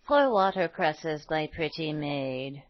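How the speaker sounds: put-on slow, low, soft old woman's mumble; mumbling old woman. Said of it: "mumbled the poor old woman".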